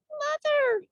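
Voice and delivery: high squeaky cry for mother; tiny high squeak; squeaky call. A narrator's reading. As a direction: squeak it out like a lost baby bat. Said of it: "Stellaluna squeaked".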